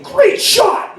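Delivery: high-pitched gleeful inner voice; secretly proud cheer. Retold or imitated. Dad privately applauding his daughter's hit, gleeful. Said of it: "inside I'm thinking".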